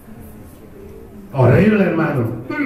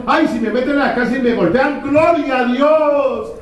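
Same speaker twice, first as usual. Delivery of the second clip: mock fervent Peter; 8 semitones higher than usual. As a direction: drag out gloria like a proud zealot. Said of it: "Antes al Pedro dijo".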